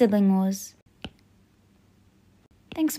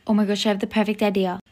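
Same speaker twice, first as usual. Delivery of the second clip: usual speaking voice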